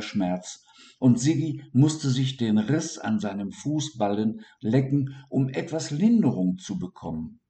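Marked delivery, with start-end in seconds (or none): none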